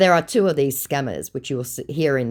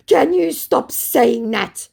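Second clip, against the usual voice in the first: high, loud, slow exasperation; mock triggered; 9 semitones higher, 6 dB louder, slower than usual. Scammer snapping at the host's taunts, mock triggered. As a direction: act out someone completely riled, dragging each word. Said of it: "completely triggered".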